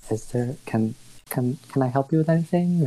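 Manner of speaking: lightly voiced shopkeeper, unsure of himself; hesitant shopkeeper Ash.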